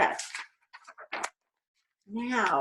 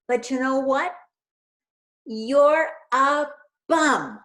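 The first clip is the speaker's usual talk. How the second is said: sassy retort; 3 semitones higher, 5 dB louder than usual. Acted. Elizabeth voiced retort.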